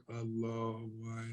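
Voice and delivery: low, drawn-out spaced-out mumble, lightly imitated; mock zonked-out voice; drugged-out talk. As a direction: imitate yourself talking while tripping, low and slow. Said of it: "that kind of ... tone".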